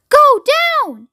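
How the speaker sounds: very high, loud yell; yelled order. A narrator's reading. Grandpa George demanding the elevator descend, yelled. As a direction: yell the command over the wind. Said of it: "yelled Grandpa George".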